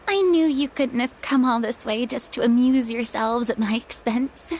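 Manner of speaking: ordinary delivery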